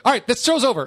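high, loud mock call-out; abrupt announcement. Host retelling ending the show for the neighbor, abrupt call-out.

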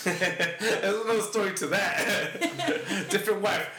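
slow, chuckled aside; laughing. Real, not acted. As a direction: chuckle through the words. Laughs at his own backstory.

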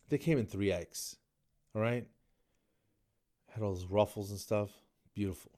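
usual speaking voice

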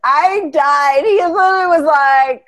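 loud, high-pitched hilarity; real amused excitement. Real, not acted. Host recalling a funny rap challenge, loud amusement. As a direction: tell it loudly, thrilled by how funny it was.

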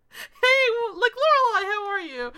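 high, loud innocent greeting; sweet, innocent Richard. Retold or imitated. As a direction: imitate an oblivious dad saying hello. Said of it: "poor, sweet, newborn baby Richard".

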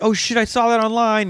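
high, excited recognition; stoked exclamation. Retold or imitated. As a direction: gush like a thrilled fan. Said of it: "You're like".

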